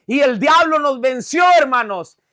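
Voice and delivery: re-enacted fervent pulpit cry. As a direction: proclaim it to rouse the congregation. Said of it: "escuchó que alcé la voz".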